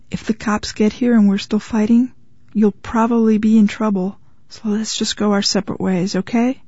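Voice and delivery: ordinary delivery, nothing marked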